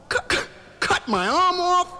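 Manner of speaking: high-pitched horrified disbelief; acted stunned outcry; shocked stammer. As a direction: stammer it out in hammed-up alarm. Patient shocked by doctor's verdict.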